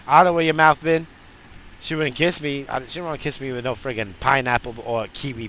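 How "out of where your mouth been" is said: wife's mock scold. Wife voiced refusing a kiss.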